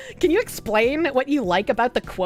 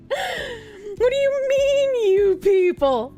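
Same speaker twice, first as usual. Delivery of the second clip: very high, slow, drawn-out indignant quote; hammed-up offended squeal; mock offense; 10 semitones higher, much slower than usual. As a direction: stretch it into a squeaky outraged whine. Repeated quote, more exaggerated.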